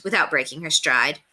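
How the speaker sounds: ordinary delivery, nothing marked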